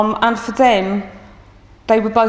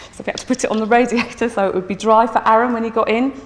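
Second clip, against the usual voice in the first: ordinary delivery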